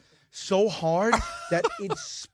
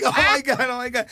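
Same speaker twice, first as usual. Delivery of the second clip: panicked cry; 8 semitones higher, 4 dB louder than usual. Retold or imitated. Retelling being choked by vet.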